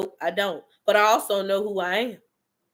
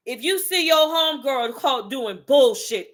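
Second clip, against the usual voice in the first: usual speaking voice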